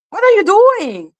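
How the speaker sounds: high, incredulous cry; exasperated outburst. Real, not acted. Host scolding the absent plaintiff, high-pitched exasperation. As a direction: burst out in disbelief, pitch leaping up.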